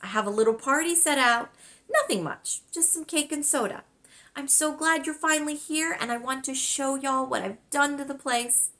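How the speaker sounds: cheerful grandmother. Acted. Grandmother voiced in story.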